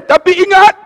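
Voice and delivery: loud high warning. Real, not acted. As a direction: hit it as a sharp reminder. Preacher warns audience. (amaran lantang)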